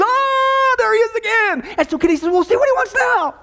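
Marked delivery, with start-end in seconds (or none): 0.0-1.8 high-pitched cry of alarm; mock scream. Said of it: "and Al screams". 2.3-3.3 high-pitched mock exasperated urgency; panicky order. Acted panicky order in joke.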